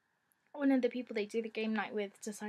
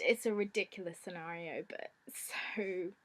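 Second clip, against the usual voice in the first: usual speaking voice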